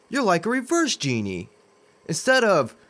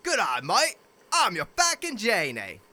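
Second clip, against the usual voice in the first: put-on a broad comic Australian accent; 3 semitones higher than usual. New genie greets his master in a mock Australian accent. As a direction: put on a hammed-up Aussie voice. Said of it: "worse accent than this next one".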